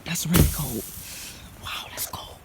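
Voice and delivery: shivering whisper about the cold. Real, not acted. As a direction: whisper through the freezing wind.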